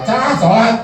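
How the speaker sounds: put-on calling the kids. Shown as a demonstration. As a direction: call the kids to prayer. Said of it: "akan tampil sama sholat". (memanggil anak-anak untuk sholat, suara tinggi dan keras)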